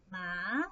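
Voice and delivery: MA rising at the end; second tone. Shown as a demonstration. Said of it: "Let's practice".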